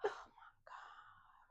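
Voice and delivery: slow, voiceless whisper; hushed, drawn-out aside; real exasperated whisper. Real, not acted. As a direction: whisper it slowly under your breath. Exasperated aside during interruption.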